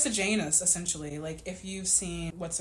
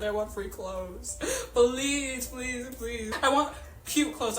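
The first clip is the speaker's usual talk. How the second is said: higher, pleading please please please; mock begging; 5 semitones higher, 4 dB louder, much slower than usual. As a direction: beg for it playfully, a touch tearful. Begging for free clothes.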